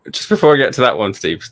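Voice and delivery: usual speaking voice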